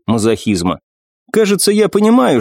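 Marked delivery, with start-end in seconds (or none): 1.3-2.4 commanding warrior goddess. Acted. Nephrite's voiced line.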